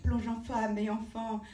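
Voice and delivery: declamatory verse with measured pauses; poem recitation. Acted. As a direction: recite it as an impassioned plea, swelling then falling at stanza ends. Recites own poem aloud.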